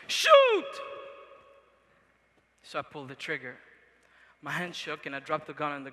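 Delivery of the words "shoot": piercing, very high shouted command, imitated; retold yelling. Rebel commander to blindfolded child with gun, imitated yelling. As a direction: shout the order at full force, as the commander did. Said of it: "he started yelling at me".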